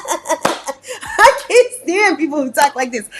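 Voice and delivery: words squeezed out through laughs; laughing. Real, not acted. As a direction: crack up while complaining. Cracks up after the impression.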